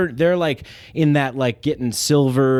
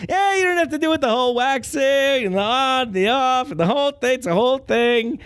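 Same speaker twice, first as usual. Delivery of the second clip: put-on much higher, whining old-man voice; whiny Larry David; 11 semitones higher than usual. Comic voicing Miyagi as a whiny Larry David. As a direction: kvetch the advice in a nasal whine.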